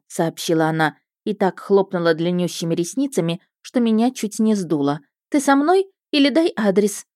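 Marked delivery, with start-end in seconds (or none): 5.3-7.0 put-on eager young woman. A narrator's reading. Roza voiced, eager sister.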